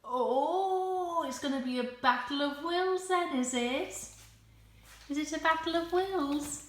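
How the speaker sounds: high, mock-challenging voice; playful challenge. Real, not acted. Dog won't give up chair.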